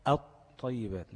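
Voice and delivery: الطيبات slowly, no L, with a doubled heavy ط; lam merged into ta. Shown as a demonstration. Teacher showing the merged lam, slow الطيبات with doubled ط. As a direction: hold the ط closure in الطيبات, at-tayyibat. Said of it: "هتنطق الطيبات".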